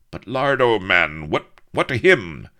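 high, loud, stammered question; urgent questioning. A narrator's reading. Avery asking about Lardo's fate, urgent and loud. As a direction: press him for the answer.

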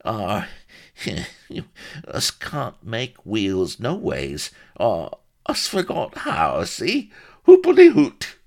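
evasive country voice, leaping to a hoot; uneasy old rustic. A narrator's reading. Solomon fibs about forgetting wheels, uneasy rustic voice. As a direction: dodge it with a nervous titter. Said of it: "Solomon grimaced and shuffled uneasily".

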